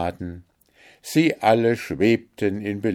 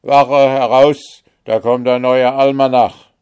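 calling out the guard; 6 semitones higher, 11 dB louder than usual. A narrator's reading. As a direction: rouse the whole watch. Guards alarm at the gate.

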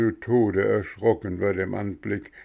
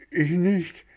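high, frightened denial; stammering; 9 semitones higher than usual. A narrator's reading. Boy stammers his denial to the gendarme. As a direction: blurt it out scared. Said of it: "stotterte Bengele".

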